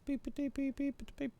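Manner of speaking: high squeaky mock beeps; imitated beeping. Mimicking a machine beep, high and quick.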